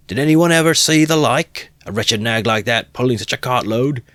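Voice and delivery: high, loud disbelief; indignant onlooker. Acted. Onlooker protesting the cruelty, indignant. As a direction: exclaim in disgust. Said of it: "said another".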